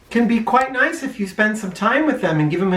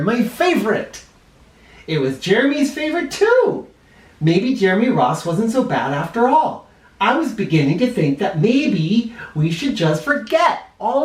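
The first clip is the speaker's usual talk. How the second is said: put-on lively read-aloud voice for children; animated storyteller reading to kids; story narration; 3 semitones lower than usual. A narrator's reading. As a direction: tell it like a bedtime picture book. Reads picture book aloud.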